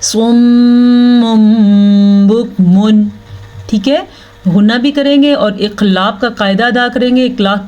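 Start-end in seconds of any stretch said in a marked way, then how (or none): 0.0-3.1 slow recitation, long hummed m in सुम्मुम; Quran recitation demo. Shown as a demonstration. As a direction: hold the nasal m of सुम्मुम, then बुकमुन. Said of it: "इसको हम कैसे पढ़ेंगे".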